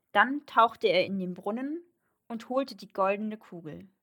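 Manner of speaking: ordinary delivery, nothing marked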